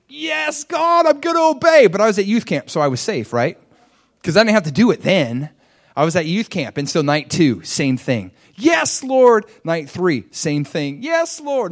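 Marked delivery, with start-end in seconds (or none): 0.1-1.9 put-on much higher, eager young promise; eager yes. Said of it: "And I'd be like". 8.6-9.5 much higher, louder keen answer; a bright yes to God; eager yes. Retold or imitated. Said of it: "same thing, replying to God". 11.0-11.7 a bright yes to God; eager yes. Retold or imitated. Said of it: "same thing, replying to God".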